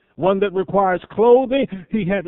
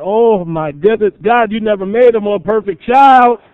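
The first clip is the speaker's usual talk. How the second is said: gushing proud parent; 5 semitones higher, 11 dB louder than usual. Pretend. Parent praising God for their newborn, gushing loudly. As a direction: swell into a proud parent's rapture. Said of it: "It's like".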